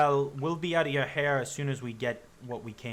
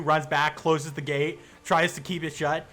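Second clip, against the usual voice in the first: usual speaking voice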